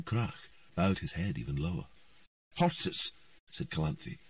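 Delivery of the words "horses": higher curt order; commanding. A narrator's reading. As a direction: bark the order. Queen commands.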